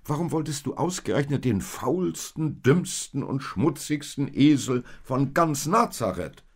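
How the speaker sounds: high, emphatic baffled question; astonished. A narrator's reading. Josef asks Maria why she wanted this donkey, astonished. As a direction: raise the voice in disbelief, leaning on each insult. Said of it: "wunderte sich Josef".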